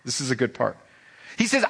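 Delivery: ordinary talk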